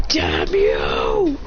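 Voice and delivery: hammed-up raging shout; enraged cry. Storyteller acts character glowing blue with rage, enraged shout. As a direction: curse him in a drawn-out yell. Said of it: "glow blue with rage".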